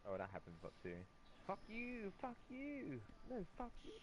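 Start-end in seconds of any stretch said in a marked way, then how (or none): 1.5-3.9 mock yelling. Mock shouting match, high pitched.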